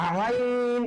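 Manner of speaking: "আইন" squeezed from the throat; long, throaty Arabic "আইন"; throat letter. Shown as a demonstration. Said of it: "কণ্ঠনালীর ব্যবহার করতে হবে".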